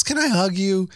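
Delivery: put-on high-pitched little boy asking sweetly; Mikey. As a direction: imitate a child hopeful for a hug. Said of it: "he goes".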